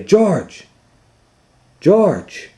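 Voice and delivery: high calling from afar. Acted. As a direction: call his name across the street. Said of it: "he heard someone say".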